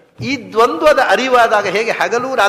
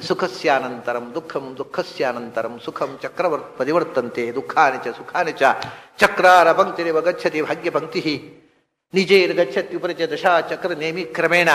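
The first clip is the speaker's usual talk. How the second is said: Sanskrit verse recitation; 6 semitones lower, 5 dB quieter than usual. Acted.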